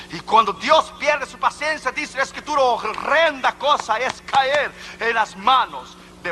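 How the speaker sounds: fervent scriptural warning; forceful preaching. Real, not acted. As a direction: proclaim the scripture high and emphatic.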